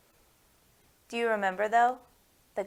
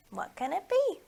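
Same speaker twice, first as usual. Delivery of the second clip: normal talking voice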